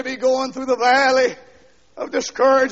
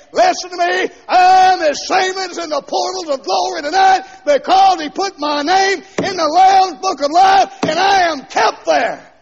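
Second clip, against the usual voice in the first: high, loud shouted preaching; 7 semitones higher, 7 dB louder than usual. Real, not acted. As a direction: drive it home at full voice. Preacher rebutting doctrine, shouting.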